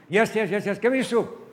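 high, loud, hungry insistence; impatient demand. Acted. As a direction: cut off the questions and demand food. Impatient brother demands soup.

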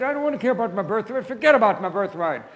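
loud, high, rushed exasperation; impatient dismissal. Acted. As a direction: wave it away, too hungry to care. Famished brother dismisses birthright.

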